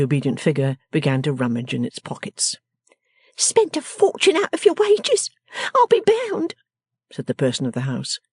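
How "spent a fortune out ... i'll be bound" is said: very high, commanding character voice; bossy little scold. Acted. Miss Wren demanding the wretched man's money, high and bossy. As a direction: order him about in a high, sharp little voice.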